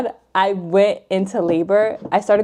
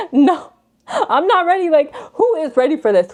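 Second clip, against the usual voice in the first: laughing protest; 9 semitones higher, 4 dB louder than usual. Retold or imitated. Laughing reply to doctors.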